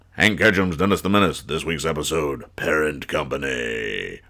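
deep dramatic TV announcer, dropping low; Quinn Martin announcer voice. Retold or imitated. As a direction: intone the title like an old cop show. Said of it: "Quinn Martin voice".

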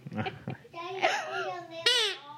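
chuckling along; laughing. Real, not acted. Dad laughs along with kids.

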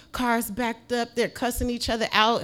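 usual speaking voice

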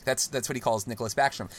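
ordinary talk

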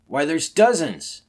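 loud, high shout of alarm; astonished cry. Acted. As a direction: shout it out, stretching the words. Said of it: "cried one of the men".

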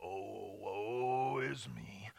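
very low, slow, soft gloomy moan; hammed-up mopey donkey lament; Eeyore. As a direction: drag it out dolefully under a raincloud. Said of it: "they came in here as Eeyore".

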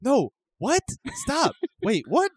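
mock confused Anthony. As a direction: play the baffled friend who never gets it. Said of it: "you just keep going".

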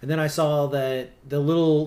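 ordinary talk, nothing marked

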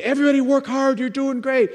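very high, over-the-top cheerleading; hammed-up pep talk to a work crew; squeaky, overeager encouragement; mock exhorter. Mock exhorter cheering on tornado volunteers.

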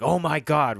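loud, high mock shock; retold cry of sudden realisation; startled outburst. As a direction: act out jolting awake in alarm. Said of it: "leaps up in his bed and is just like".

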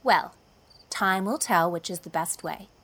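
mildly resigned verdict; philosophical. A narrator's reading. Friend ending a debate as they part, philosophical. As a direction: close the argument with light acceptance. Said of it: "said Jane philosophically".

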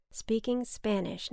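ordinary delivery

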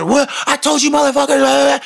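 high-pitched mock rant; hammed-up high, strained outburst; suddenly charged up. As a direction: imitate a man jolting back into a tirade. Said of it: "he'd get charged".

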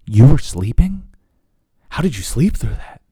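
mock disbelief at his sleeping; imitating shocked wife. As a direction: play the wife aghast that he slept through it. Wife's shocked reply, retold.

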